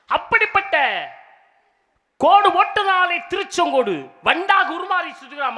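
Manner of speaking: loud, high-pitched ringing proclamation, last words held long; stage declamation. Acted. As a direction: proclaim the temple legend to the whole crowd. Stage declamation of temple legend. (உரத்த மேடைப் பிரசங்கம்)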